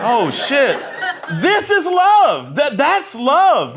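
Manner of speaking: high, loud, slow awed realization; mock-weepy, high, slow awe; overwhelmed in love. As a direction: let it wash over you, stunned.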